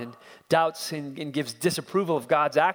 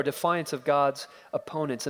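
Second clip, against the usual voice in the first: ordinary delivery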